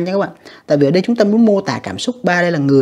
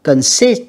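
"Consist" higher, SIST stressed; second-syllable stress; 4 semitones higher, 4 dB louder than usual. Shown as a demonstration. Teacher showing students word stress, con-SIST with the second syllable stressed. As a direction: hit the last syllable of "Consist". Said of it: "âm hai nha".

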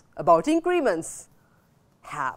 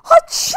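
loud high fake sneeze "Aching!"; hammed-up playful achoo; mock sneeze; 11 dB louder than usual. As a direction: pretend to sneeze, big and teasing. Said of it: "I'm teasing also".

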